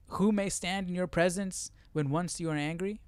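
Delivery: ordinary talk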